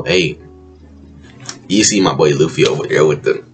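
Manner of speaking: ordinary delivery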